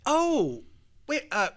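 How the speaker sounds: high, halting, as if just remembering; casual afterthought put on in a high voice; sudden realization. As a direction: act like it only now occurred to you. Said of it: "it just dawns on me".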